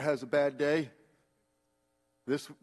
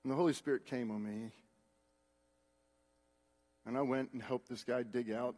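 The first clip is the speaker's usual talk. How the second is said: ordinary talk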